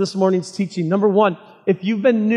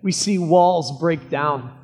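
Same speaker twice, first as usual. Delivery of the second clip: prayer. Acted. Pastor leads opening prayer.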